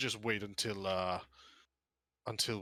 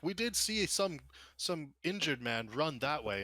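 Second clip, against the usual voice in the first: high-pitched witness chiming in; piping up; 6 semitones higher, slower than usual. Acted. Genasi witness telling guards of injured man, piping up. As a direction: pipe up higher, slowing toward the end. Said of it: "one of the Genasi pipes up".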